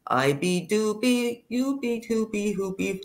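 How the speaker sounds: slowed-down Doobie chant. Shown as a demonstration. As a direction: drag each word out. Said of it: "I'll say it slower".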